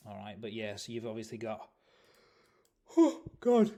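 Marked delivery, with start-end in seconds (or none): 3.0-3.7 big yawn breaking through the words. Real, not acted. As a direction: groan it out mid-yawn. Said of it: "Sorry, I keep yawning".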